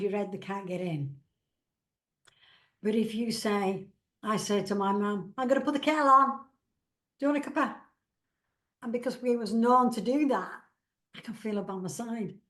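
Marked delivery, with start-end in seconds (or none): 5.4-7.8 higher, louder, quick and bright, like calling from the kitchen; chirpy offer of a cuppa, raised voice; calling out to mum about the kettle, cheerfully; cheery call to mum. Retold or imitated. Calling to late mum at home.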